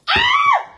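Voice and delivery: sudden high yelp of alarm; a sharp high startled scream, cut off fast; startled shriek. Real, not acted. Caught off guard while unboxing, a high shriek.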